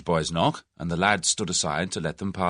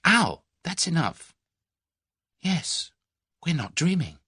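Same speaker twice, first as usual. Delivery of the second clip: put-on higher, breathless young woman in awe; thrilled young woman; 8 semitones higher than usual. A narrator's reading. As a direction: gasp it out like a dream come true. Tuppence thrilled about the job.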